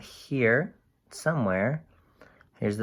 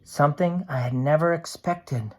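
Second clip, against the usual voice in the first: lively storybook voice for a can's diary; cheerful picture book narration; reading to children; 4 semitones higher, 5 dB louder than usual. A narrator's reading. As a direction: read it bright and animated to a class. Reads picture book aloud.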